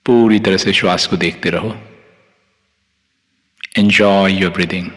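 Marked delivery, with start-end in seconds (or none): none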